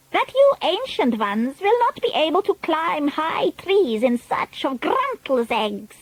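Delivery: high, imperious witch voice; Grand High Witch. A narrator's reading. Grand High Witch addressing the ancient witches, imperious. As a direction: address the old witches with disdain.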